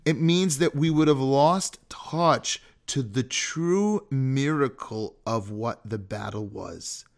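ordinary talk

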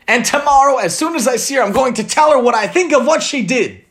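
bitter. Acted. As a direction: spit it out bitterly. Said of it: "concluded Leah bitterly".

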